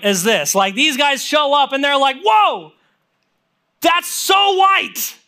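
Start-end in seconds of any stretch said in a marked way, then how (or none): 2.2-5.2 high, drawn-out awestruck cry; stunned, high-pitched wow; astonished. Retold or imitated. Acting out women stunned by robes.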